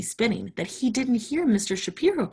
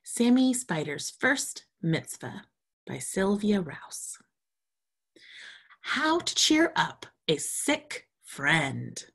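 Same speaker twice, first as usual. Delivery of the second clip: title announcement; slower than usual. Acted. Teacher announcing the picture book title to the class.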